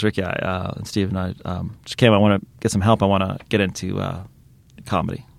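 low, halting, deadly serious; Liam Neeson. Retold or imitated. Serious actor asks comedians for help with comedy, solemn. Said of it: "he's always really serious".

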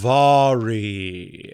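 big, long drawn-out call; Goliath shout. Acted. Lone Walker calling out at the temple doors, booming Goliath shout. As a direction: shout it out slowly, stretching every syllable. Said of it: "Lone Walker will shout out".

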